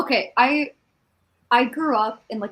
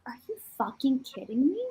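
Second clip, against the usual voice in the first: breathless, can't believe it; stunned whisper; 5 dB quieter, faster than usual. Real, not acted. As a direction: whisper it in disbelief, a little fast. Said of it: "disbelief at Angela Bassett's age".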